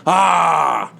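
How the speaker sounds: put-on loud drunken KGB bellow, high; imagined drunk KGB guy yelling. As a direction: roar it like a drunk agent. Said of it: "what a drunken K G B guy would sound like".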